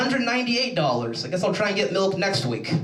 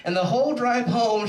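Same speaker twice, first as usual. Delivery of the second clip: normal talking voice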